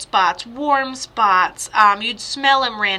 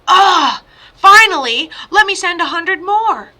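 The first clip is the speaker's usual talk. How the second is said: exasperated; 8 semitones higher, 8 dB louder than usual. Retold or imitated. Medium voices exasperated spirit Logan.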